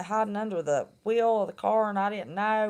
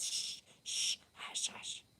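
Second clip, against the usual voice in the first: soft whispered shh, hush, hush; toddler's shushing whisper; 16 dB quieter, slower than usual. Retold or imitated. Grandson fingers on grandma's lips, whispered shushing. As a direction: hush her like a little boy with fingers on her lips.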